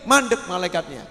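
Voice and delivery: usual speaking voice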